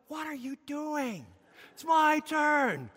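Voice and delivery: slow mock complaint to a queue-jumper; mock indignant. Cut-off driver confronts the man who took his turn, mock indignant.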